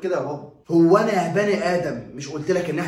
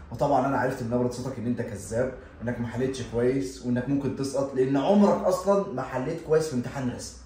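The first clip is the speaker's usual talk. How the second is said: normal talking voice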